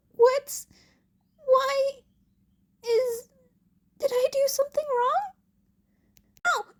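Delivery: high, fast, loud flustered questions; panicked boy. Acted. Izuku panicking that he did something wrong. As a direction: babble in panic.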